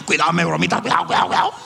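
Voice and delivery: mock fearful fuss. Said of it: "Usted le va a decir eso".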